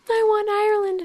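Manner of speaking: put-on high, petulant childish whine; mock whine. Guest mocking their own disappointment in a high whiny voice. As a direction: pretend to sulk like a let-down tourist.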